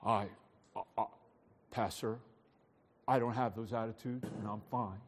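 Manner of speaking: low, quiet, halting protest; hesitant churchgoer. Pretend. Imagined churchgoer objects to the pastor, hesitant and quiet. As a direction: stammer a soft, mock excuse to the pastor. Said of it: "Some of you might be saying".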